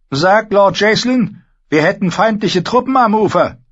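high, loud urgent command; commanding order. Acted. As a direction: order the runner off sharply. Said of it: "trug er einem der Läufer auf". (befehlender Ruf)

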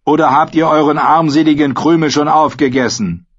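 scornful taunt. A narrator's reading. Karl mocks the standing Lord Commander, scornful taunt.

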